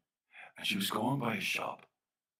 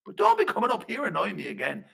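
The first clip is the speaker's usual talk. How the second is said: high, loud warning; annoyed fairy man; 12 semitones higher, 9 dB louder than usual. Acted. Fairy man tells Flanagan not to return, loudly annoyed. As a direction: send him off testily.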